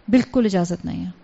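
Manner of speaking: usual speaking voice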